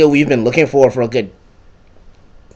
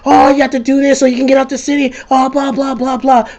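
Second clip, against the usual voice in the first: high, fast nagging yell; mock nagging Moya; 13 semitones higher, 4 dB louder than usual. Moya nagging the player over the earpiece, mock yelling. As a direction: imitate someone yelling in your ear, high-pitched. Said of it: "just yelling at me all the goddamn time".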